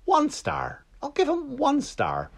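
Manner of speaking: squeaky high, drawn-out mock voice; mock petty verdict. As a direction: pipe it up high and slow like a petty little judge. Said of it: "But I'm like".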